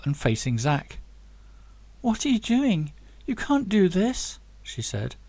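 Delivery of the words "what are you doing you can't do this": higher, lightly pleading. Acted. Rhea stepping between the men, mildly pleading. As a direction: beg him to stop. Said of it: "pleading with him".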